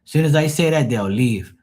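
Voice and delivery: ordinary talk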